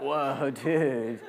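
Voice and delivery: laid-back surfer-dude drawl; dazed, stoned California dude; California stoner voice. Retold or imitated. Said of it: "my California, like, stoner voice".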